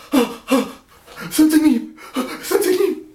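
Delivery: high, loud gasping deference; flustered awe. Retold or imitated. Boss suddenly sees the old man, flustered high gasps. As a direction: gasp it out, startled and reverent. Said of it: "the boss sees the old man and goes".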